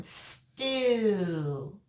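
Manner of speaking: "Stew" with a long drawn-out vowel at the end; slow, held "Stew", falling away; isolated open syllable. Shown as a demonstration. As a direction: stretch "Stew" so the final vowel rings on. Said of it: "listen to the first one".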